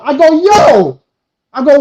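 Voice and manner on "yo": loud, high indignant call; yelled. Retold or imitated. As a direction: re-enact yelling at a stranger. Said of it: "I mean, I yelled".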